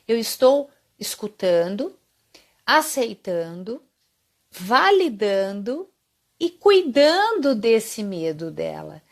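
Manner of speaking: each step slowly and deliberately; spaced out, weighty listing; slow emphatic list. Real, not acted. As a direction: lay out each word with a pause. Listing steps of caring.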